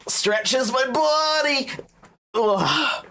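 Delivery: slow, high stretching drawl; mock stretch groan. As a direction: stretch the words out lazily high.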